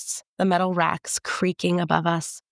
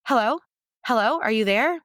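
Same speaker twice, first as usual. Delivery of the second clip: calling out; 5 semitones higher than usual. A narrator's reading. Calling after silence.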